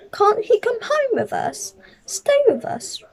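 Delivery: high pleading voice. Acted. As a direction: beg him to let it come home. Mrs Brown pleads in story.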